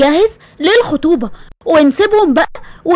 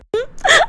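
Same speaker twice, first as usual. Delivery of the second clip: a short high giggle; amused laugh; 11 semitones higher, 4 dB quieter than usual. Acted. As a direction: laugh it off lightly. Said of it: "وهدير قعدت وضحكت".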